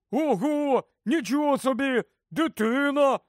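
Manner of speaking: high-pitched cries of wonder; amazed exclamation. A narrator's reading. Ron hears of a baby, exclaiming in amazement. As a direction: exclaim as if it's unheard-of news. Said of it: "Рон вигукнув".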